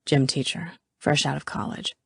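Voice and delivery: low, hushed aside; whisper soft. A narrator's reading. Garrett tells Callie about Adams, murmuring softly. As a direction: keep it soft and confidential. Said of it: "whisper soft".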